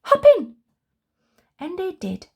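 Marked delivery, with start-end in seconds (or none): 0.1-0.6 excited call. Acted. Rush urging them into car.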